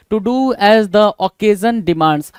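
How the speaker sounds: very slow, word by word; slow dictation. Shown as a demonstration. Dictating meaning again.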